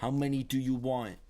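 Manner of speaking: put-on a rough try at a Brooklyn accent. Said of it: "I can't do a good, like, Brooklyn accent right now".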